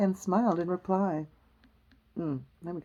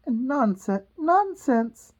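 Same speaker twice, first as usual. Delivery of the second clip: high, firm, raised voice; loud and decided; 6 semitones higher, 6 dB louder, much slower than usual. Acted. Alice defying the Queen, loud and decided. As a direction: cut in loudly and decidedly. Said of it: "very loudly and decidedly".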